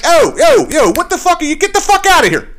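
high, loud yelling at the guys on his car; retold yell. Ranting owner yelling at landscapers on his car, loud and high. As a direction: shout them off the trunk, pitch way up. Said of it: "I was like".

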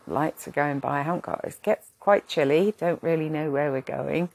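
normal talking voice

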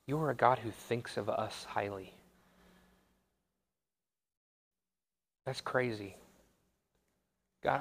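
soft, subdued praying voice with pauses; quiet prayer. Real, not acted. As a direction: speak gently and reverently to God. Leads closing prayer.

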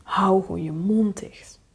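dropping into a near-whisper; hateful inner thought. Acted. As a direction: think it under your breath with loathing. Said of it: "je gewoon je ouder begint te haten".

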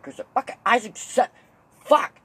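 loud, high outburst building to a shout; short-circuiting dad. Retold or imitated. Dad mixing up his kids' names, exasperated shout. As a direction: sputter wrong names, exploding on the last word. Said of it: "I got my dad short-circuiting once".

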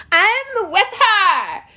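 hammed-up cheerful voter; mock slogan. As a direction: parody a campaign chant. Mocking slogan.

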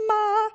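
"Ma" sung out high and level; high-pitched ma. Shown as a demonstration. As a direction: hold "Ma" on a high steady note. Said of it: "say ma with a high pitch".

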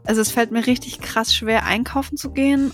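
soft, scripted sponsor read. Acted. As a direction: read the ad like casual chat.

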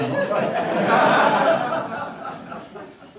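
long, loud, high burst of laughter; big helpless real laugh. Real, not acted. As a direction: crack up at your own joke. Laughs at his own joke.

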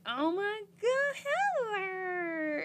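very high, slow, stretched delight; mock overjoyed greeting; thrilled. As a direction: squeal the name like seeing an old friend. [name] greets old contestant.

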